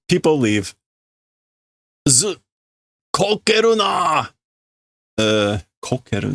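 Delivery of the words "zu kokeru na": angry shout. Acted. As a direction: shout the order at him. Workers shout at the leader.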